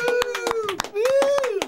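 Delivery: very high-pitched woo; high falsetto whooping; celebratory whoop. Real, not acted. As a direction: let out two shrieky cheers. Cheers the episode sign-off.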